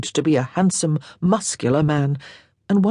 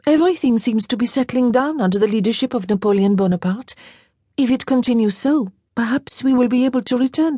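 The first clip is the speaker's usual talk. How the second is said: put-on light, higher girlish voice; a lightly voiced young lady, wistful; young Frenchwoman, a bit higher and brighter; young French girl; 6 semitones higher than usual. A narrator's reading. Girl's voice held by narrator.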